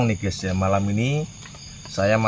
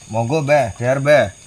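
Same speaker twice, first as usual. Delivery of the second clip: calling out; 3 semitones higher, 5 dB louder than usual. Pretend. Inviting spirits to eat.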